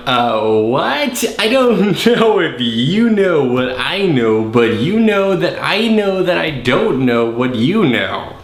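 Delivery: slow, swooping singsong voice; hammed-up Alex. Alex answers Ben's joke, slow hammed-up bafflement. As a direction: stretch every word in mock bafflement. Acting Alex's muddled reply.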